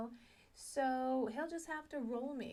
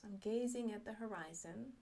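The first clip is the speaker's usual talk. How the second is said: normal talking voice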